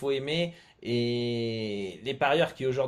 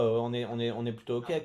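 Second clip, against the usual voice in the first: usual speaking voice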